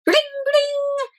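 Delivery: high chiming bling bling like a bicycle bell; bell ring voice. Acted. Bicycle bell sound in story.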